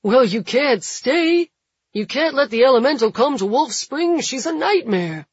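urgent shouted plea; crowd protest. A narrator's reading. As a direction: call it out from the crowd. Crowd calling out to Jules.

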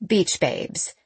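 usual speaking voice

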